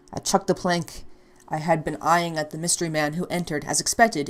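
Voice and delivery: ordinary talk, nothing marked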